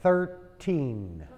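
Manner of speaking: "Thirteen" ending in a crisp n; thirteen with clear n. Shown as a demonstration. Teacher drilling "Thirteen" with a clear n. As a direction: sound the final n in "Thirteen". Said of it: "La N hay que pronunciar".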